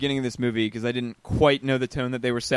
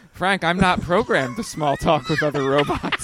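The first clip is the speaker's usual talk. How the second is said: robot voice; 3 semitones higher than usual. Retold or imitated. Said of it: "the robot's response is being like".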